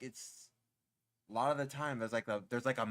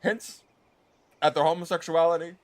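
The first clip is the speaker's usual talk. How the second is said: loud, higher mock presidential speech; Obama; 4 semitones higher, 8 dB louder than usual. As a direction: lightly impersonate a president making an emphatic address. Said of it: "It's me, Obama, speaking through [name]".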